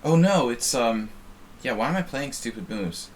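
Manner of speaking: ordinary delivery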